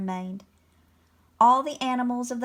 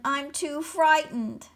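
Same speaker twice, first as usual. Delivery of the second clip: wailing; 5 semitones higher, slower than usual. A narrator's reading. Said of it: "wailed the dragon".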